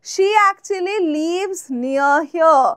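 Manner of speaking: very slow, clear classroom read; slow, careful teacher example; slow example sentence. Shown as a demonstration. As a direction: spell it out slowly for learners. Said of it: "example sentence".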